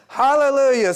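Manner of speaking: high, loud cry of praise; exclaimed hallelujah. Real, not acted. Preacher calling out praise to the congregation, loud and high. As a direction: shout it to the rafters.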